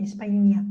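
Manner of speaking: "España" with a clear ñ, ny sound as in canyon; ñ sound shown. Shown as a demonstration. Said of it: "para hacer el sonido ñ".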